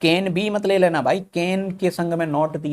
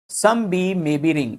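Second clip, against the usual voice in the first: slow dictation; much slower than usual. Shown as a demonstration. Teacher reading the statement slowly.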